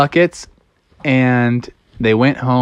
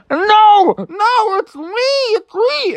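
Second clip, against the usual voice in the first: high pleading cries; desperate Froggy; much slower than usual. Acted. Froggy hooked, pleading with dad, high desperate. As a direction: beg to be recognised. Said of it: "And Froggy's like".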